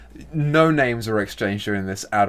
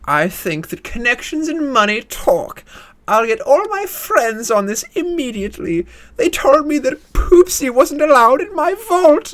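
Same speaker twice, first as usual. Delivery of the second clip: much higher, louder haughty matron; outraged society lady; 13 semitones higher, 6 dB louder than usual. Acted. Rich lady to Akan about her dog, much higher huffy outrage. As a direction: puff yourself up, high and loud.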